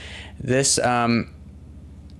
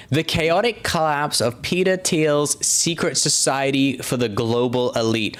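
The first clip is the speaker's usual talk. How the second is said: dramatic headline read; 3 semitones higher than usual. Acted. Host reading a scandal headline to viewers, mock-dramatic announcer tone.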